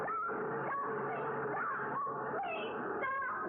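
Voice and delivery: high desperate cries; calling out desperately. Acted. Woman waving at the speeding bus, crying out high and desperate. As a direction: call out to stop the bus.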